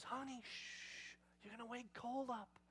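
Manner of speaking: hushed shushing. Retold or imitated. Mom to daughter about waking the baby, hushed shushing. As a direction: shush gently, drawing out the warning.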